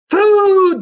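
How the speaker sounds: loud, high bellowing shout; roar. Acted. Cyclops roars for his dinner, loud high shout. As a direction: roar it at the wife. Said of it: "the creature roared".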